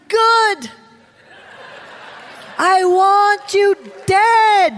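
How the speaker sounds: high, loud, drawn-out pronouncement; God/character. Retold or imitated. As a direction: deliver it as a slow, forceful divine verdict. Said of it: "I heard the Lord".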